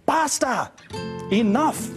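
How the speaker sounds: high-pitched sharp command; tiny commander calling a halt; little crabs ordering. A narrator's reading. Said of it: "ordered the little crabs".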